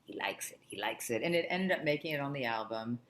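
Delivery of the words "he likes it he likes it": low, soft aside in his voice; hushed confiding. Retold or imitated. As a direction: pass on good news under your breath. Herbie confides Paul's approval.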